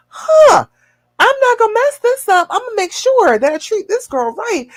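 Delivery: high-pitched awestruck resolve; mock-amazed man vowing to do better; imitating amazed boyfriend. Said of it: "He's gonna be like".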